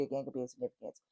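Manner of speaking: ordinary talk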